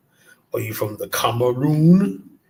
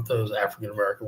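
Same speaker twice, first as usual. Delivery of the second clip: ordinary talk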